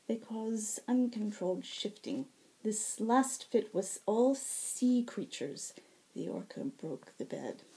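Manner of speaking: lightly voiced tired healer, wry and exasperated; weary healer. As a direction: explain it like a worn-out nurse. Nether explains Finn's fits.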